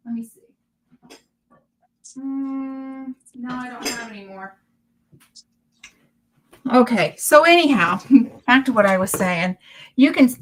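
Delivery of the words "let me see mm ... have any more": soft, absent search talk; quiet self-directed mumble; muttering to self. Real, not acted. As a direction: think aloud under your breath while looking.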